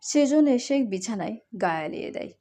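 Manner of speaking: ordinary delivery, nothing marked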